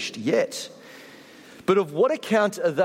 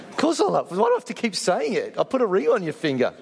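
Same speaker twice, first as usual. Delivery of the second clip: higher-pitched, hurried husbandly protest, hammed up; mock exasperation; 4 semitones higher, faster than usual. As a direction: protest as if fed up with being asked. Mock exasperated husband answering his wife.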